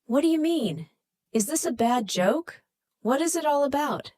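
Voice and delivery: mildly hostile questioning; unfriendly voice. A narrator's reading. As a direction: push back at the caller, suspicious and cold. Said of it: "answers with an unfriendly voice".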